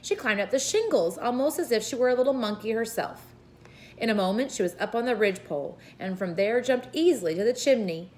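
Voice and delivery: ordinary delivery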